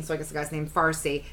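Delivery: ordinary talk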